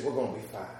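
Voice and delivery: low, soft, calm reply; quiet reassurance. Retold or imitated. Grandfather calmly assuring the curious speaker, soft and low. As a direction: hush it, settled and sure.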